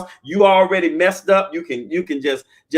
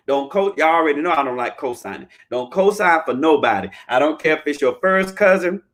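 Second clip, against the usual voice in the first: normal talking voice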